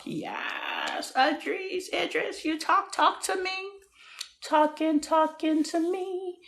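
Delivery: high-pitched playful swoon; high, drawn-out flirty pleading; mock swooning. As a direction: coo it high, like a smitten fan. Swooning over Idris Elba's accent.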